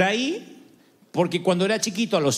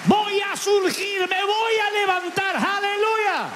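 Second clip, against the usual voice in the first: shouted declaration; 12 semitones higher, slower than usual. Real, not acted. Preacher leading the congregation in a shouted prayer. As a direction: cry it out in triumph.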